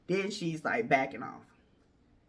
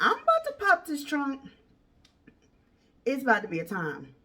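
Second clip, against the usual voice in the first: tough threat; 6 semitones higher than usual. Retold or imitated. Retold standoff, threatening to fight.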